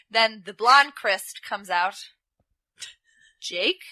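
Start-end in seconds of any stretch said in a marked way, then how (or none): none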